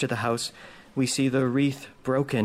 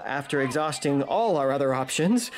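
ordinary talk, nothing marked

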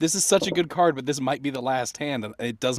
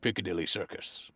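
deep announcer voice; 7 semitones lower, 5 dB quieter than usual. Pretend. Reading card in deep voice.

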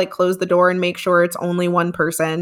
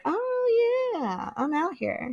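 casual mock reply; 9 semitones higher, 9 dB quieter, much slower than usual. Acted quote of casual reply.

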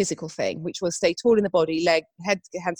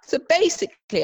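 usual speaking voice

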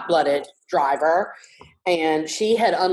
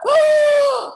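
high, loud shocked "Oh!"; the teacher clutches her pearls; shocked teacher gasp; 7 dB louder than usual. Retold or imitated. Teacher hears a five-year-old swear, gasping a high loud "Oh!". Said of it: "the teacher was like".

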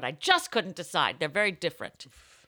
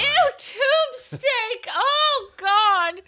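high, loud, drawn-out gross-out squeal; disgusted shriek; 9 dB louder, much slower than usual. Real, not acted. Reacting to her friend's crude joke with a high, loud, disgusted squeal.

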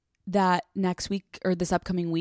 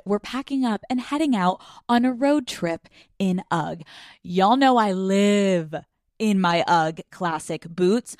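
upbeat host read with drawn-out key words; 4 dB louder, slower than usual. Acted. Host pitches boots to Daddy Gang, lively ad read. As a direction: sell it to the fans, stretching phrases. Reads a sponsor ad.

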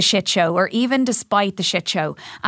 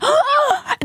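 mock gasp. Mock shock at gossip.

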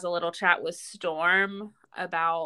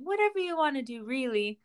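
mock Natasha line; 6 semitones higher, 3 dB quieter, faster than usual. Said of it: "her favorite line".

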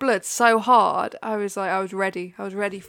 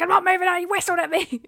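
high, louder indignant protest; refuse, pitched up and outraged; retold angry rant; 7 semitones higher, 3 dB louder than usual. Ranting to Freya after being whistled at, angry protest. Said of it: "I'm, like, ranting away to Freya".

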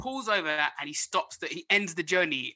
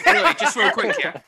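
laughing; 7 semitones higher, 10 dB louder than usual. Real, not acted. Laughing, trying to start story.

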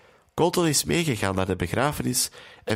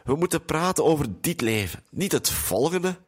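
higher-pitched, understated tearful upset; mildly tearful; 5 semitones higher than usual. A narrator's reading. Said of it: "zegt Sonja met tranen in haar ogen".

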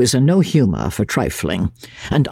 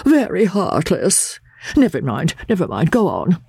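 high, self-pitying invalid's lament; piteous sighing; 11 semitones higher than usual. A narrator's reading. Invalid calling his visitor heartless, piteous and high. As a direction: sigh it out as a wounded martyr. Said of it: "sighed piteously".

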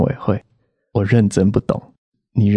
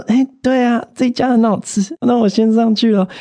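forced politeness; 11 semitones higher than usual. Retold or imitated. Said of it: "忍着我的怒火".

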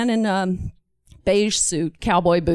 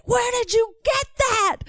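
high, exploding mock fury; about to blow; 14 semitones higher than usual. Warden about to pop a gasket, acted fury. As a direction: play it like a gasket popping. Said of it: "he's gonna pop a gasket".

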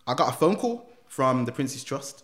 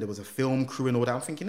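ordinary talk, nothing marked

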